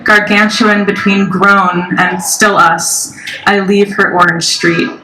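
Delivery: slow, measured recital with long pauses; deliberate, spaced-out poem cadence; poetry reading. Acted. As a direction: draw out each line and let it drop. Reads third poem.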